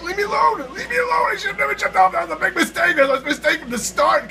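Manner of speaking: high, loud desperate cry; panicked yell. Real, not acted. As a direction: scream as you fall. Panicking mid-jump.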